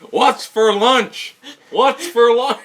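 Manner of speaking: high, loud drawn-out holler; shouted catchphrase. Pretend. Friend yelling a running joke, high and loud.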